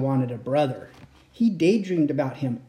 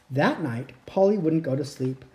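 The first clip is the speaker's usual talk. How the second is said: ordinary delivery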